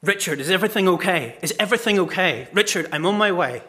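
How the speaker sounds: dad rushing to the rescue; urgent fatherly concern. Retold or imitated. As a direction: fire out anxious reassurance down the phone. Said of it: "he suddenly says to me".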